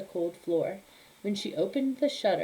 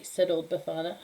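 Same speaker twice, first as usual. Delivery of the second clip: ordinary delivery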